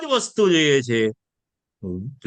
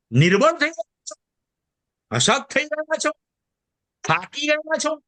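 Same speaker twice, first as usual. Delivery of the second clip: rising appeal; 9 semitones higher than usual. Real, not acted. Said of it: "preacher asks the congregation".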